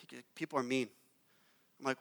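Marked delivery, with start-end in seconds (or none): 0.3-0.9 softer, lower, a touch of hurt; quiet wounded young voice; mildly hurt kid. Retold or imitated. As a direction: voice a tired upset worker gently. Upset worker's words retold.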